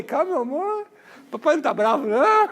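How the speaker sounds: mock-soothing dad, high and loud; exaggerated calm. Father hastily reassures daughter after the rebuke, comically exaggerated calm. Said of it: "fiquei calmo".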